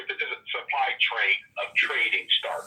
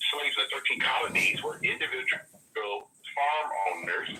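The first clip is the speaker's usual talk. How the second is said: ordinary talk, nothing marked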